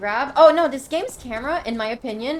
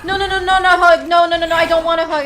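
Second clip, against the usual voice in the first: loud, higher, rapid pleading cries; panicked shout; 6 semitones higher, 9 dB louder, faster than usual. Real, not acted. Player grabbed by a monster, panicked pleading. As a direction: beg it away in a panic.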